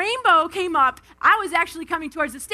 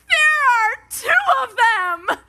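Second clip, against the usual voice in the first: much higher and louder, crying out in delight; thrilled child; 9 semitones higher, 5 dB louder than usual. Retold or imitated. Aunt acts out her niece crying out over the double rainbow. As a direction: shout it like a kid who just got the biggest ice cream cone. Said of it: "biggest ice cream cone".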